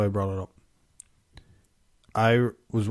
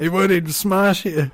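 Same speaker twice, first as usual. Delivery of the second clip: laughing boast; 10 semitones higher, 4 dB louder than usual. Real, not acted. Teasing friend, laughing boast.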